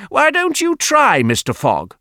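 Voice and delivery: high-pitched goading question, falling on the name; pointed challenge, pitched high then dropping; challenging dare. Acted. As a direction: dare him, voice leaping up. Sceptic challenges Fogg to try.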